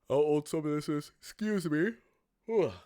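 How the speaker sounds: talking through a yawn. Real, not acted. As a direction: yawn mid-sentence. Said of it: "Yawnage incoming".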